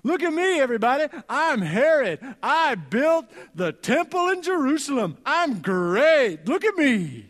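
loud, high, silly bragging voice; Herod, goofy show-off. Retold or imitated. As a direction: ham up a vain king begging for attention. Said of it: "So here's Herod".

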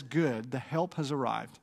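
unimpressed, as if the owner is staff; haughty cat. Retold or imitated.

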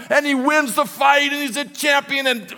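high, loud, exultant storytelling; triumphant excitement. Real, not acted. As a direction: keep the victory rush at full voice. Climax of the fight retold.

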